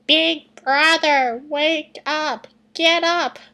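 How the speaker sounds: put-on high, slow childish little-brother call; a kid whining big brother awake; Mokuba voice. Little brother calling his sleeping big brother, high and childish. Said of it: "that was my Mokuba voice".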